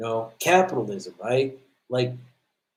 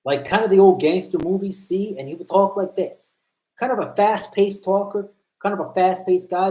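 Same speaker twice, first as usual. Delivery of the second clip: imitating a Newfoundland cop like an old gangster movie, pitched higher and louder; fast-talking Newfoundland gangster-movie voice, raised; Newfoundland accent; 6 semitones higher, 4 dB louder than usual. Recalling a Newfoundland cop at battle school, in a higher gangster-movie Newfoundland accent. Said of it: "Let me see if I could do the accent".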